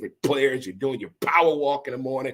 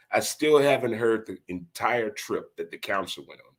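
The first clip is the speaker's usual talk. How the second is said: ordinary talk, nothing marked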